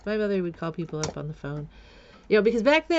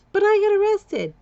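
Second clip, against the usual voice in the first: much higher, louder exclamation; outraged; 13 semitones higher, 8 dB louder than usual. Real, not acted. Telling viewers about the unfair arrest, loud and high. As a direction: cry out at the injustice.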